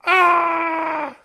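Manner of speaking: high-pitched Home Alone style scream; mock horrified scream. Co-host playing along with a Home Alone joke, mock horror. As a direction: let out a playful, high yell of horror.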